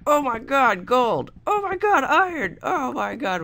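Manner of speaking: very high, loud squeal of excitement; hammed-up falsetto freak-out over treasure. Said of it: "I was freaking out".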